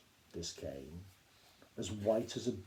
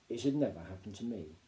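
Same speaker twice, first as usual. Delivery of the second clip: ordinary delivery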